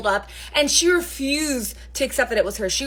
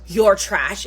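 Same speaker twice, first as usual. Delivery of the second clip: stern mother; 2 semitones lower, 3 dB louder, much slower than usual. Retold or imitated. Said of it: "the mother roommate had to sit her down".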